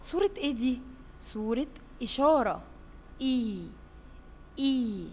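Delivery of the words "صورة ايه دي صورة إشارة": normal talking voice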